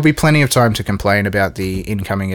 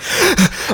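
high, sudden gasp for air; hammed-up breathless gasp; sharp gasp. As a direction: gasp like you just burst in breathless. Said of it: "Bursting breathlessly into a room".

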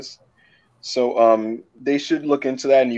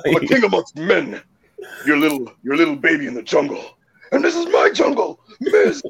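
big booming promo voice, laughing; booming wrestler boasts; 5 semitones higher than usual. Retold or imitated. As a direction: bellow it like a wrestling heel. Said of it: "It's just like".

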